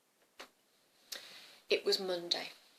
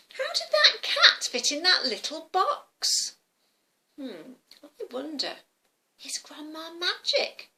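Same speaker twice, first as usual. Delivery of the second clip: high, slow puzzled musing; wondering little girl; 7 semitones higher than usual. Acted. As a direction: think it out as an amazed child. Girl puzzles over the magic.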